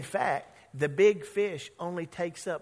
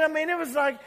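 ordinary talk

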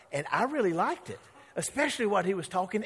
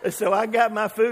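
normal talking voice

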